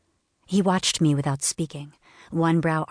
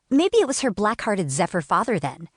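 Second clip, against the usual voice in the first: higher, louder, contemptuous jab; spat insult; 5 semitones higher, 3 dB louder than usual. A narrator's reading. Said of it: "Tiana spat".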